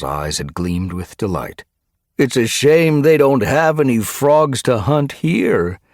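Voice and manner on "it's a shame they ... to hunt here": high, loud mock pity; gleeful taunt. A narrator's reading. Delighted guard taunting the trapped cats, gleeful taunt. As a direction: crow it at the enemy.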